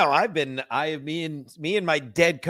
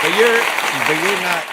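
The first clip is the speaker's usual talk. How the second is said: loud cut-in; 3 semitones higher, 6 dB louder than usual. Real, not acted. Cuts in over ranting host.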